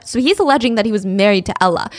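ordinary delivery

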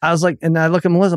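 ordinary talk, nothing marked

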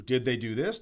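ordinary talk, nothing marked

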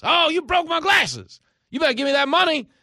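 loud hammed-up hustler; yelling grifter. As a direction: accuse him loudly to cause a scene. Grifter's scam repeated.

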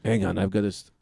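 usual speaking voice

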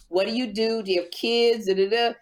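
ordinary delivery

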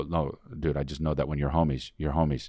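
a low-key bro confiding; hushed bro voice. Retold or imitated. Imitates friends answering, lower.

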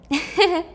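high mocking laugh; short sneering laugh; cruel laugh. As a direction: laugh with hatred.

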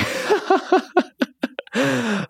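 high burst of real laughter; sudden high-pitched laugh; big laugh. Real, not acted.

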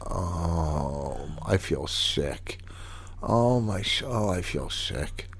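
low, soft, queasy groaning; sick and miserable. Retold or imitated. Husband imitating the drunk friend feeling sick, low and soft. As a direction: moan it like you might throw up. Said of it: "within no time".

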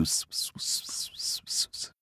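breathy whispered ps ps; whispered signal. Acted. Brer Rabbit giving the birds the secret whispered signal. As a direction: hiss the secret signal softly.

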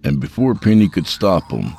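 ordinary delivery, nothing marked

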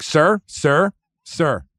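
a hint of a stern dealer calling sir; casino lady's warning. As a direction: lightly mimic a firm casino attendant. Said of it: "the lady's just like".

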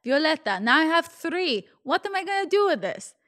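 mock Russian mom, increasingly indignant; poor Russian accent. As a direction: scold in a put-on Russian accent, building to a high complaint. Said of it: "my really poor Russian accent".

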